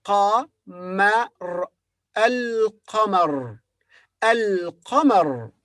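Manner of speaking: al-qamar slowly, lam sounded; al-qamar, l clearly pronounced; Arabic al-qamar. Shown as a demonstration. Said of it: "So here we say al".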